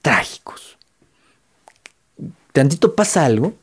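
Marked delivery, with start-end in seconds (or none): 0.0-0.8 whispered "Crea escenarios trágicos", slow. Shown as a demonstration. Speaker warning viewers about the worst trait, whispered. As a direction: hush it like a secret warning. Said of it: "Esto es lo peor de todo".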